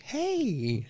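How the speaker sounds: high-pitched drawn-out greeting; wrong demon voice. Retold or imitated. Demon in the corner turns around, imitated high. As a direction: mimic the demon too high. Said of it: "He doesn't. He actually sounds really, like, deep, creepy".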